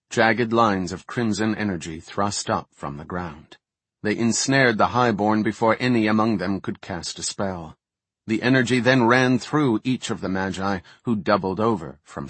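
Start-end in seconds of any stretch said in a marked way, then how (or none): none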